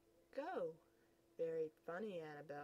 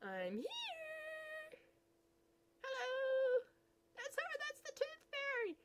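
very high, light fairy voice; tiny fairy voice; slower than usual. Acted.

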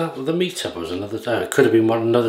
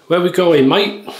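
higher, louder cheery cabbie voice; a lightly voiced Cockney taxi driver; London cabbie; 6 semitones higher, 4 dB louder than usual. Cabbie calling back to his passenger, cheery Cockney. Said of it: "he says".

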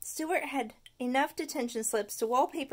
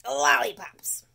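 tooth voice, muffled, a bit louder; 7 dB louder, slower than usual. Acted. Tooth answering from Stuart's mouth, muffled. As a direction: call it out from inside the mouth. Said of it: "said a muffled voice".

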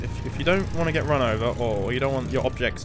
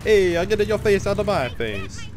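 higher, slow, hammed-up Italian accent; exaggerated Italian accent; 7 semitones higher, 4 dB louder, slower than usual. Player parroting a game character, hammed-up Italian accent. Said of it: "my incredibly racist Italian accent".

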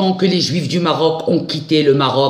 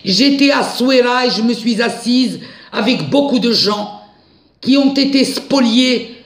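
indignant; 8 semitones higher than usual. Real, not acted. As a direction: demand answers, voice climbing. Indignant rant about missing money.

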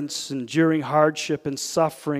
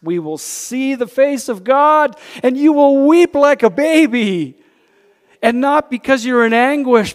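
loud, high preacher's exhortation; preaching at full force; 12 semitones higher, 13 dB louder than usual. Acted. Preacher rousing his congregation, loud full-force preaching. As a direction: lift the room, preach it out.

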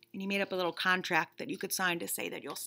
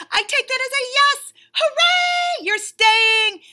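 much higher and louder, screamed with joy; ecstatic shout; 15 dB louder, slower than usual. Acted. Bunny celebrating that the reader agreed to stay, very high and loud. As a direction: cheer at the top of your lungs.

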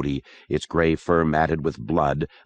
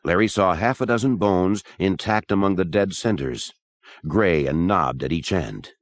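plain reading voice

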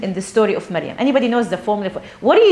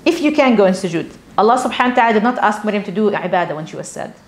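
normal talking voice

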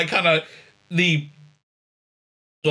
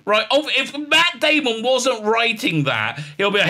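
ordinary talk

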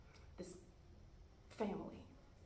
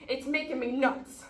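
loud, raised-pitch complaint; exasperated; 4 semitones higher, 8 dB louder than usual. Acted. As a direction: burst out, nerves frayed. Snaps at brother's whispering.

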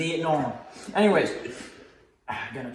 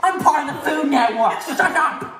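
mock angry yell; 7 semitones higher, 5 dB louder than usual. Host yelling at laughing cameraman.

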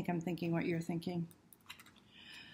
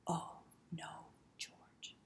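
soft whispered run-through; whispered rehearsal; 11 dB quieter, much slower than usual. Shown as a demonstration. Reader rehearsing the refrain with children, whispered. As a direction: hush it like a secret practice. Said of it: "remember those words?".

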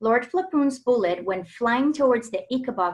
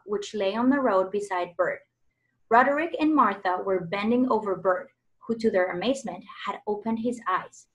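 ordinary delivery, nothing marked

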